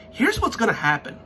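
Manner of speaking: ordinary talk, nothing marked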